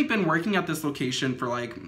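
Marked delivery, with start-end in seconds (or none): none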